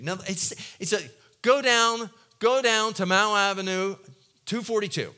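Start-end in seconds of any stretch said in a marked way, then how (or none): 1.4-5.1 casual directions. Retold or imitated. God giving Ananias an address.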